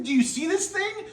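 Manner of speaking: high giddy gushing; excited. Real, not acted. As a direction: gush with amazement. Gushing over the board.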